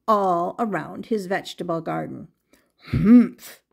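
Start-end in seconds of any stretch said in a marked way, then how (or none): 2.9-3.6 a loud annoyed humph; grumpy gardener's loud huff; huffy humph. Acted. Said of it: "he huffed".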